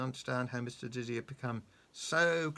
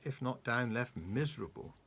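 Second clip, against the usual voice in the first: ordinary delivery, nothing marked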